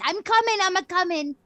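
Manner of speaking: high, loud, hollered reassurance; calling out. Acted. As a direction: holler back to impatient cats. Calling out to her cats.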